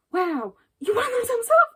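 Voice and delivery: a high, hammed-up imitation of the sister marvelling; mock-surprised sister. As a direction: play her as wide-eyed and clueless. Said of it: "is all like".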